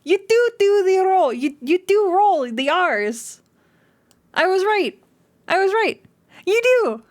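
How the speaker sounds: excited exclaiming. Real, not acted. Streamer gloats to friend about rolling R's, squealing excitedly. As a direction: gloat happily at being right. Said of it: "that makes me so excited".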